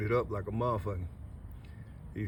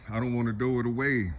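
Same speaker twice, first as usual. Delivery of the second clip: usual speaking voice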